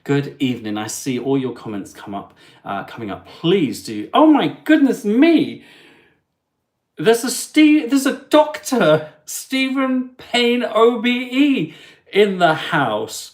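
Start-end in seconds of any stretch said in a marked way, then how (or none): 4.1-5.6 high, loud gasp of delight; surprised. Real, not acted. Spotting the Doctor in chat, loud delighted surprise. 7.0-13.3 high, loud, slow announcer call; excited announcement. Real, not acted. Hailing Doctor Payne in chat, loud excited announcement.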